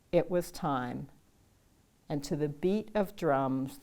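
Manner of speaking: plain reading voice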